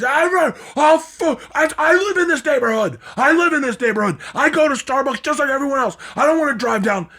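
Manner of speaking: put-on high, loud whiny protest from an indignant old man; mocking the old man. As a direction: squeal it out like a cornered old driver. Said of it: "topped me with his".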